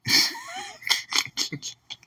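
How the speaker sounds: a long, high burst of laughter. Real, not acted. Laughing that the wife gave her the number, a high laugh. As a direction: crack up at how dumb she was.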